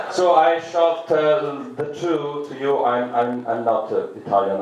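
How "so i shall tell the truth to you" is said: ordinary talk, nothing marked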